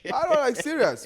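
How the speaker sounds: high, loud outburst; loud exclamation. Real, not acted. Co-host talking blunt real talk amid banter, exclaiming loudly. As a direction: burst out well above normal pitch.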